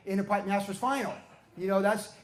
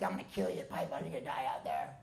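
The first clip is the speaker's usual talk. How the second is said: imitating Roy; 3 semitones lower, 6 dB quieter than usual. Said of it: "he's like".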